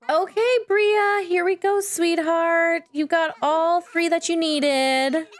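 put-on high, sing-out encouragement to a baby; excited cooing. Player to Bria, who got all three, excited cooing. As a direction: coo in a lifted, eager pitch.

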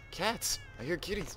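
high, thrilled little squeal; real delight. Real, not acted. Player hears kitties in the game, high delighted squeal.

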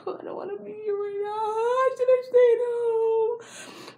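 high, drawn-out fake wail; hammed-up whimper of dread, slow and stretched; mock whining. Acts out her awkward inner thoughts.

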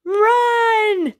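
a sudden high, loud RUN; shouted run. Acted. Reading to a child, she shouts the cue to run. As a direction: burst out with the word like a starting gun.

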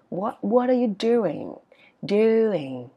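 slow "what are you doing", "doing" sliding down; falling question tune. Shown as a demonstration. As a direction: draw out "doing" downward. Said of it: "ngữ điệu nó sẽ đi xuống". ("doing" xuống giọng cuối câu)

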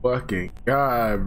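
ordinary talk, nothing marked

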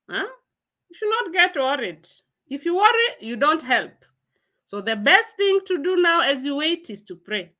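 normal talking voice

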